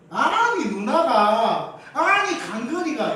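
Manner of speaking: 높고 크게, 말끝을 길게 늘인 아이의 항변; 남매 탓을 하며 징징대듯 길게 끌기; 억울한 아이 흉내. Acted. 싸운 남매가 서로 탓하는 흉내. (억울한 아이처럼 크게 말끝 늘여 따지기)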